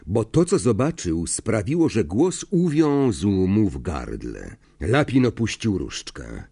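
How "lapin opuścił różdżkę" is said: usual speaking voice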